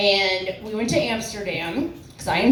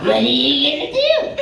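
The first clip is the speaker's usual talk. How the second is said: quoted catchphrase; 4 semitones higher, 4 dB louder than usual. Retold or imitated. Comic quotes a catchphrase for the crowd, in a high put-on voice. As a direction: stretch it out in a higher, put-on voice. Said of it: "If you understand what that is from".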